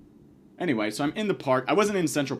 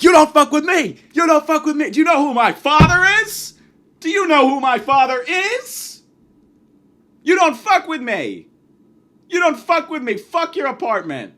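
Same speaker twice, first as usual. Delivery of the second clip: much higher, much louder hammed-up yelling; heated shout; 14 semitones higher, 10 dB louder than usual. Comedian yelling back at the stranger over who is richer, shouting. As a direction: scream like a spoiled heir losing it. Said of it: "we got a little bit heated".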